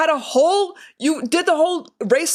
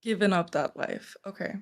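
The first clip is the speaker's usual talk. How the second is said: sarcastic echo; 4 semitones lower, 11 dB quieter than usual. Real, not acted. Sarcastic echo of his claim.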